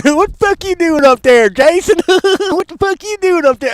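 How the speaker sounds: high loud mock quote through laughter; high laughing parody; laughing mockery. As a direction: laugh while mocking the line. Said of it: "quoting trailer line".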